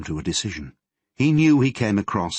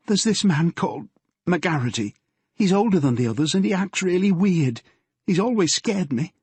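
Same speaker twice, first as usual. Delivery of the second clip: put-on higher, earnest girl's voice; nervous girl confessing to her father; teenage daughter Yvonne; 10 semitones higher than usual.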